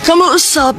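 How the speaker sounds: ordinary delivery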